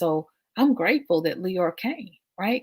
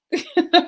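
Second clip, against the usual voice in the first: a quick delighted laugh, pitched up; high bright laugh; 13 semitones higher, 9 dB louder than usual. Real, not acted. Laughing at herself over chicken dinner.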